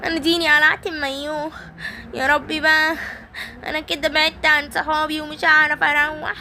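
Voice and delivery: high, whimpering, hammed-up sobs; mock crying toy. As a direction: wail about being lost, high. Simba alone, fake crying. (بكاء تمثيلي بصوت عالٍ)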